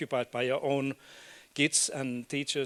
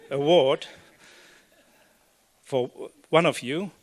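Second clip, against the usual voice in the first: emphatic announcement; 9 dB louder than usual. Real, not acted. Teasing prize announcement.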